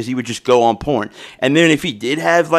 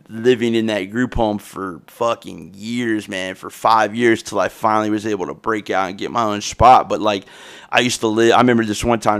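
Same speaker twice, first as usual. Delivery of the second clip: ordinary talk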